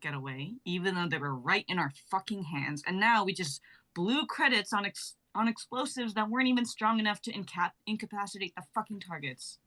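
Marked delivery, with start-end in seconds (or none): none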